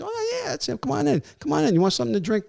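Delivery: high-pitched, hospitable retold invitation; eager welcome. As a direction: imitate a host eagerly waving guests in. Relative voiced welcoming the police in.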